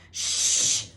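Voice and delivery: sharp hissed shush; urgent shush. A narrator's reading. Hagrid hushing Ron in the library, sharp urgent shush. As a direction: cut him off with a quick shush.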